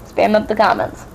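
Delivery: ordinary delivery, nothing marked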